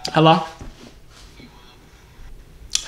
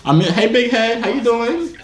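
higher, coaxing flirtation; playful sweet-talk; 8 semitones higher than usual. Pretend. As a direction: turn on the charm, pitch climbing as you go. Sweet-talks pretend ex in prank.

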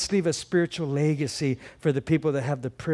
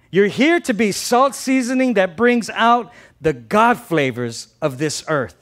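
loud, high preaching declaration; loud proclamation; 5 semitones higher, 6 dB louder than usual. Acted. Preacher voicing Jesus' answer to the church, loud and emphatic. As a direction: proclaim it forcefully to the whole room. Declaiming the quoted verse loudly.

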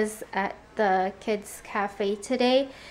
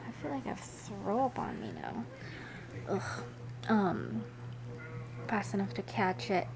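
hushed voice; 2 semitones lower, 10 dB quieter than usual. Real, not acted. Talks softly at 3 a.m.

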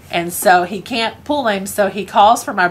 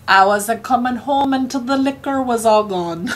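put-on dad's higher drunken excuse; lightly voiced tipsy dad, pitched up; 5 semitones higher than usual. Dad answering worried mom after his bender, drunken excuse. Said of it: "And he said".

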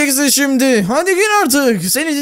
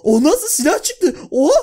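laughing surprise; 8 semitones higher than usual. Real, not acted. Shocked at the gun.

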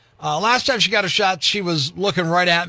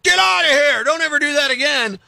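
pretend scream; 6 semitones higher than usual. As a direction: yell him out of the room as an outraged dad. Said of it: "just scream at him like".

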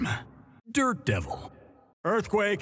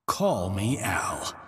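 whisper; 6 semitones lower than usual. Acted. A joking aside, whispered.

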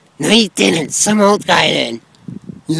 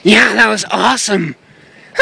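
ordinary talk

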